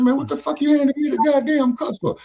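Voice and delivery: mock indignant rant at the counter worker; retold angry outburst. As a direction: throw it back at him like you're fed up. Retold confrontation at McDonald's.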